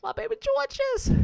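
put-on "My baby Georges" squealed very high; squealing coo. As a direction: squeal it with pity. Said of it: "I'm just like".

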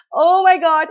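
loud, high burst of joy; overjoyed disbelief. Retold or imitated. Mother hearing labour has begun, loud joyful disbelief. Hearing she will give birth.

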